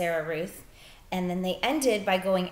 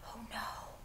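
stunned whisper; 4 semitones higher, 14 dB quieter than usual. Acted. Bryce as his sister dies.

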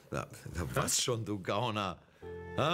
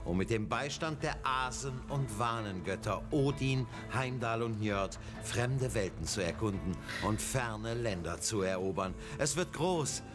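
announcer; 5 semitones lower than usual. Acted. Grand announcer intro.

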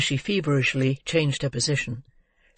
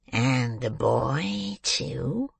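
very slow, drawn-out probing question; wheedling old man; much slower than usual. A narrator's reading. Old man straining to glimpse the girl's face, wheedling. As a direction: coax it out, lifting at the end.

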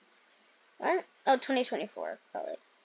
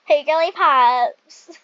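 louder, slow greeting through a laugh; 8 dB louder, slower than usual. Shown as a demonstration. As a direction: call out Hey, Girly Pops while laughing. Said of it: "I'm gonna say that".

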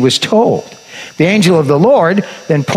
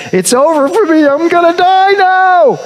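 hammed-up wail. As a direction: play it as a comic doom wail. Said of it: "he wailed".

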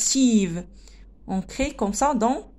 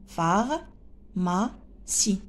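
"Pharmacie" very slowly, lower, as phar, ma, cie; split into syllables; 4 semitones lower, 4 dB quieter, much slower than usual. Shown as a demonstration. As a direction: count out "Pharmacie" in three spaced beats. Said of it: "On a trois syllabes". (« Pharmacie » scandé lentement en trois syllabes)

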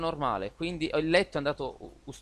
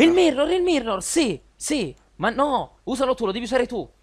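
frantic; 10 semitones higher, 8 dB louder than usual. Real, not acted. Frantic rush for the mirror.

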